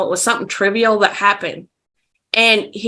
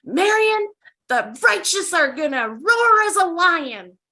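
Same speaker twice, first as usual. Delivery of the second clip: man screaming down phone; 6 semitones higher, 3 dB quieter than usual. Retold or imitated. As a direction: imitate a distant man ranting righteously. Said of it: "man screaming down the phone".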